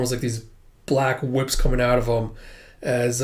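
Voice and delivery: usual speaking voice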